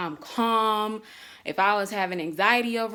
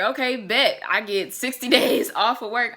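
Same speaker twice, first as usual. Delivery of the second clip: higher, louder delighted reply; retold excitement; 4 semitones higher, 7 dB louder than usual. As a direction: play back your gleeful reaction, half laughing. Retelling own delighted reply to husband.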